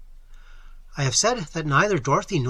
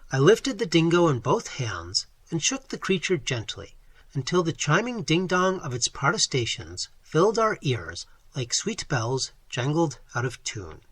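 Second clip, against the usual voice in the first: ordinary delivery, nothing marked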